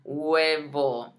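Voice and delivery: "Huevo" starting straight on "weh", no H; silent H. Shown as a demonstration. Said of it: "Pretend it's not even there".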